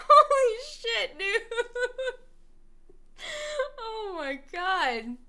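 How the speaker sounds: laughing so hard the voice squeaks up high; real wheezing laugh at the absurd story; helpless laughter. Real, not acted. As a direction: crack up, talking through the laugh. Still reacting to the story.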